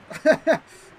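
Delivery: a sudden real laugh; short amused laugh; burst of laughter. Real, not acted. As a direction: laugh it off at the teasing. Laughs at chat teasing.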